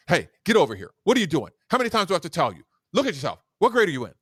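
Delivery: loud scolding teacher; daytime DJ scolding. Retold or imitated. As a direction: bark it at a misbehaving student. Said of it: "That's your daytime D J voice for something negative".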